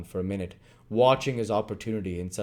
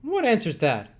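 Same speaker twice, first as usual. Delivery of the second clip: higher, louder angry retort; 12 semitones higher, 5 dB louder than usual. Acted. Sikes snapping back at Nancy's evasive answer. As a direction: throw her answer back at her. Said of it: "retorted Sikes".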